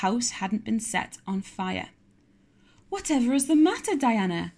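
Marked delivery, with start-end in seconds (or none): 2.9-4.5 high, loud startled question; girl crying out in surprise; alarmed cry. Acted. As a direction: call out to her friend in alarm. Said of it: "cried Anne".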